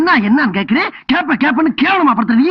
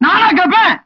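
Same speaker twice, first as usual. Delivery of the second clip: high indignant shouting, breaking into cries of அம்மா; outraged shout; 7 semitones higher than usual. Acted. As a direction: yell in wounded pride. Husband outraged, then cries out.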